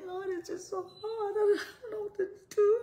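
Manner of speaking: high, loud, tearful wail to the Lord; crying plea. Retold or imitated. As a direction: cry it out like you are at the end of your rope. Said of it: "and you may be saying".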